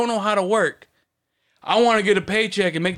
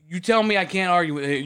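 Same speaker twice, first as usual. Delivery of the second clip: normal talking voice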